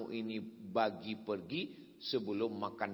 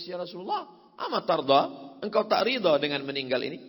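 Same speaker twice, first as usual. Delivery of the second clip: louder, higher, urgent sermon voice; fervent preacher warning against wailing; preaching at full force; 7 semitones higher, 9 dB louder than usual. Acted. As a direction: press the point hard to the crowd.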